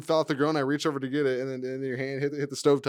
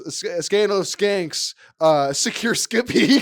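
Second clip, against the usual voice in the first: slow, hissed scandalous skanks, secure Skippy; scandalous skanks secure Skippy, s sounds drawn out, high and loud; drawn-out s like a snake; 7 semitones higher, 7 dB louder, much slower than usual. Pretend. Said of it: "slogan chanted slowly".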